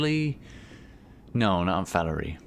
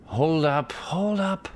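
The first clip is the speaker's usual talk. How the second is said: high raised call to stop; 10 semitones higher, much slower than usual. Acted. Archibald calls the party to stop, high and raised. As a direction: shout them to a halt, voice climbing. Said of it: "Archibald calls out".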